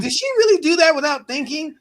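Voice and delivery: mock-amazed, voice climbing; retold disbelief. Retelling his surprise at the name.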